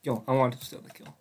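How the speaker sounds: normal talking voice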